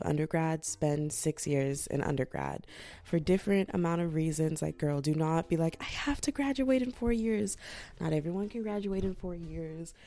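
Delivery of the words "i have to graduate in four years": high-pitched, put-on student fretting; mock insistence. Mimicking students anxious to graduate on time, mock fretting.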